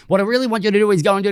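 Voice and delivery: rattled off at top speed, pitch up; speaking flat out into a voice note; fast voice-note dictation. Shown as a demonstration. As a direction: blurt it out flat out like a quick voice note. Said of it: "I can go flat out".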